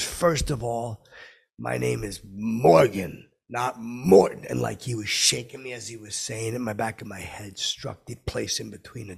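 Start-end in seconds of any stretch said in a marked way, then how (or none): 0.0-4.4 slow, loud, indignant impression; drawn-out, forceful celebrity imitation; Morgan Freeman. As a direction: correct him deliberately, punching "Morgan" and "Morton". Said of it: "He goes".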